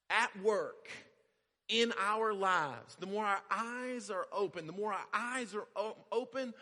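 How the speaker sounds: loud, high climactic preaching; preacher driving home his point; preaching at full force. Acted. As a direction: build the sermon to its peak. Preaching builds.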